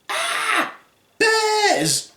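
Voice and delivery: very high mock-terrified cry; frightened shriek. Girl waking up to see bears, terrified. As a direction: squeal in put-on alarm.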